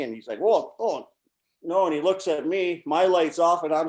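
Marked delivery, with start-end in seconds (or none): 0.4-1.9 1 caught off guard. Retold or imitated. Teammate caught, denying.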